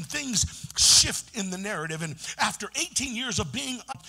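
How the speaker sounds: ordinary delivery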